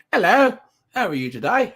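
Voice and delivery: light British accent, held back in the throat; a hint of a reserved British greeting; British pullback demo. Shown as a demonstration. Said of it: "they tend to pull back".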